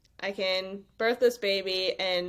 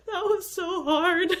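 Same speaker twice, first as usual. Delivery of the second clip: exhausted sigh; 10 semitones higher, 3 dB louder, slower than usual. Retold or imitated. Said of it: "and I just go".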